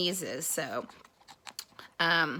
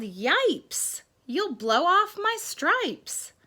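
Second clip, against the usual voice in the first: high, drawn-out storybook yelp; zebra's yell; 9 semitones higher, 4 dB louder, much slower than usual. Acted. Zebra fearing for its stripes, mock yell. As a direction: yell it higher and louder, stretching the words. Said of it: "The zebra yelled".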